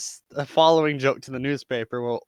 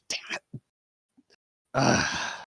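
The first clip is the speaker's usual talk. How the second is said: soft, voiceless curse under the breath; hushed in-character frustration; tense muttered curse; 4 semitones lower, 4 dB quieter, slower than usual. Acted. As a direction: curse quietly to yourself, then sigh. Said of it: "gripping the seat a little tightly".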